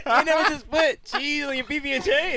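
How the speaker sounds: high giggling disbelief; laughing. Real, not acted. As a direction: push the words through laughter. Laughing at friend's sandwich.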